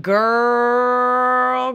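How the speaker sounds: long, loud, high-held greeting; big drawn-out holler; calling out greeting. Pretend. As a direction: stretch it out like greeting an old friend. Imagined greeting on meeting Christine.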